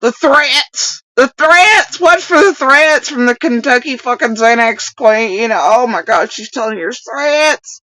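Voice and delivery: high, loud mock alarm; mocking echo. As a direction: parrot it back in exaggerated outrage. Mockingly parrots the played clip.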